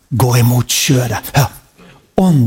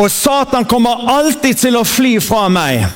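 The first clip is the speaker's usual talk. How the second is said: slow, proclaiming call for the congregation; preacher leading a spoken creed; leading a confession; 7 semitones higher, slower than usual. Acted. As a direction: declare it for them to echo. Leads confession to repeat.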